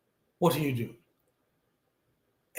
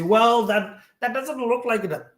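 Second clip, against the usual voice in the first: mock skeptic; 8 semitones higher, 5 dB louder than usual. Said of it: "And you say".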